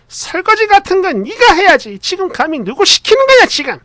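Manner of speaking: high scolding mother-in-law voice; mock angry yell. Mother scolding the wife over dishes, high acted yelling. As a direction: put on an outraged, high-pitched parent. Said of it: "이렇게 소리치시며".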